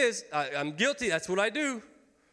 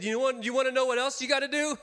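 quick, higher-pitched teasing wife; mock wife voice; 7 semitones higher, 4 dB louder, faster than usual. Wife teasing her husband about his habits, mock higher voice. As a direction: toss it off fast like a wife with a zinger. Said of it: "she said".

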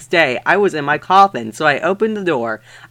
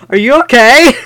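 much higher, louder anxious call; crying out; 8 semitones higher, 8 dB louder, faster than usual. Acted. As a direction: shout it in alarm. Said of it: "I cried".

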